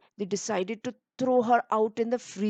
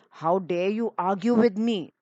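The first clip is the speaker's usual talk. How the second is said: angry. A narrator's reading. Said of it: "Helena got very angry and she said".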